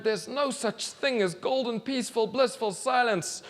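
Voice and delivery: frustrated rant. Acted. Boy ranting.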